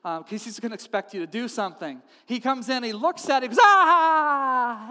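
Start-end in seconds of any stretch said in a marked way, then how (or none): 3.6-4.9 loud, high, drawn-out "aah"; horrified cry. Acted. Doctor seeing diseased skin, horrified cry. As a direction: cry out at a ghastly sight.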